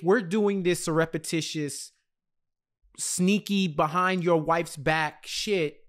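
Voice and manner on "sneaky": usual speaking voice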